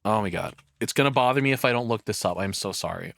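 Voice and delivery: ordinary delivery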